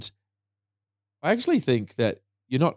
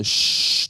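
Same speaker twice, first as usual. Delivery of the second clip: brief "shh" set into the sentence; quick illustrative shushing sound; demonstrated shush; 4 dB louder than usual. Shown as a demonstration. Public speaker quoting what not to say, brief shush.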